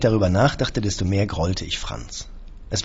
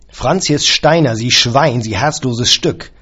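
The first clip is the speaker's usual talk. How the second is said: loud, slow and higher, spitting each insult; furious insults; 4 semitones higher, 7 dB louder, slower than usual. A narrator's reading. Employer insulting his caretaker on the phone, loud and slow. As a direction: hurl the insults down the line.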